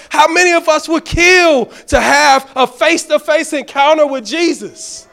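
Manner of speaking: preacher thundering the titles of Christ; full-force preaching. Acted. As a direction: build the sermon to its peak.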